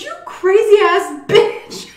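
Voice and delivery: high, loud, drawn-out exclamation; mock outraged yell. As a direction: holler it in amused disbelief, imitating the uncle. Uncle's outburst after the prank reveal.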